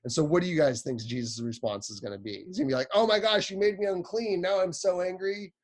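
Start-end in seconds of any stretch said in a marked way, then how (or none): 2.9-5.5 high, hammed-up mock outrage; mock angry. Youth leader mocks an angry Jesus for the kids, hammed-up outrage. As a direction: play a pretend-furious Jesus, tongue in cheek.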